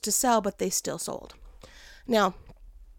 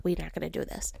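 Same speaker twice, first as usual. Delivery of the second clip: quiet resolve; 5 semitones lower, 8 dB quieter, faster than usual. Retold or imitated. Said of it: "I was like".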